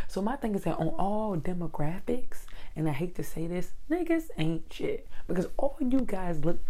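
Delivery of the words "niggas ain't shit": exasperated. Real, not acted. Punchline in a high, slow voice.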